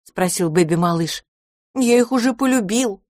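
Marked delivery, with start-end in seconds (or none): 1.7-3.0 put-on high, eager little child voice; little boy voice. A narrator's reading. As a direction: speak like a small pleading boy.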